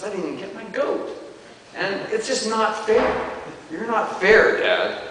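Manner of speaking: sulky son grumbling he was cheated; whiny complaint. Acted. Older brother whines to his father about unfairness.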